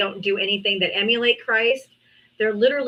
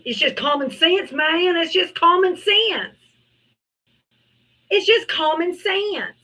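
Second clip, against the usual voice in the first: mocking dim voice; 7 semitones higher than usual. Mocking a Trump supporter's reasoning.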